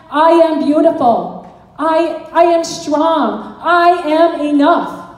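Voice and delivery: slow, deliberate affirmations. Shown as a demonstration. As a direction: say each one like you mean it. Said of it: "you put on there".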